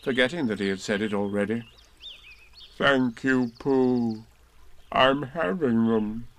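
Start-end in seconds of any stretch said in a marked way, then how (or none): 2.8-6.3 slow, mournful thanks; gloomy. A narrator's reading. Eeyore thanking Pooh, gloomy and slow. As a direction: droop through every word. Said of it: "said Eeyore gloomily".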